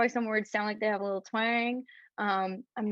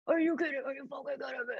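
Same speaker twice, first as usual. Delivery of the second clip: mock New York anger; 5 semitones higher, 4 dB quieter, much faster than usual. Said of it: "my New York explodes".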